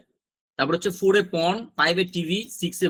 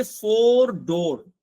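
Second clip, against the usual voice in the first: slow, drawn-out ফোর ডোর; teacher modelling ফোর ডোর slowly; slow model; 2 semitones higher, 3 dB louder, much slower than usual. Shown as a demonstration. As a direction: stretch ফোর ডোর out for the class. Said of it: "ফোর এর উচ্চারণ ডোর এর মতো".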